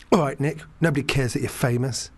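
usual speaking voice

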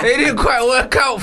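laughing through the words, slowed; real laugh breaking into the line; chuckling out the punchline. Real, not acted. Laughing at own joke.

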